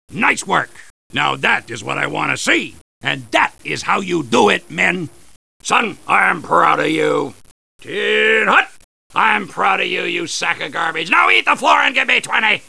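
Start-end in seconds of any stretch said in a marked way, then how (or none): none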